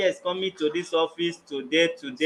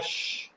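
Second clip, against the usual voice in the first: drawn-out shh to silence someone; sharp hushing sound; 9 dB quieter than usual. Real, not acted. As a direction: hush them with a shh. Mediator hushes the interrupting party.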